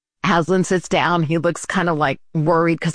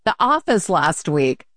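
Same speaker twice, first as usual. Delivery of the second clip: higher, sing-song teasing voice; mock prank call; 8 semitones higher than usual. Listeners pranking Russell about the office, higher teasing voice. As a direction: needle him like you know a secret. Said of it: "call Russell and prank him".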